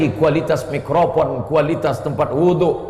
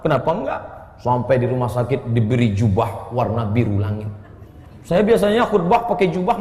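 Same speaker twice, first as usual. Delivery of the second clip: ordinary talk, nothing marked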